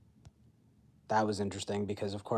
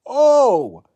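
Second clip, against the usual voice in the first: high, loud surprised "Oh!"; a sudden loud startled cry; exclaiming; 16 dB louder than usual. Real, not acted. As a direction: blurt it out in shock.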